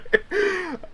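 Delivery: high giggling laugh; drunk laugh. Acted. Drunk Chad giggling as he's pulled away. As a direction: cackle like a drunk.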